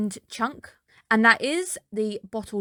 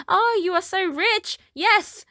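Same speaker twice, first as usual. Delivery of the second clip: much higher, hammed-up sarcastic gushing; over-the-top sarcasm; 10 semitones higher, slower than usual. Elena mocks Emil's wealth: over-the-top sarcastic. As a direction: lay the fake awe on thick, way up high. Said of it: "she kinda goes O T T sarcastic Elena".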